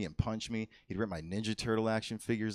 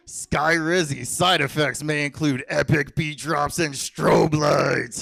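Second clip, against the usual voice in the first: louder hyped-up ad voice, slow; mock drug ad; 5 semitones higher, 10 dB louder, slower than usual. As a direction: sell it like a party pill ad.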